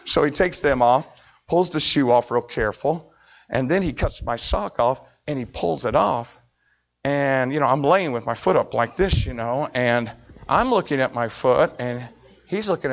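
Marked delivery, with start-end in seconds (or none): none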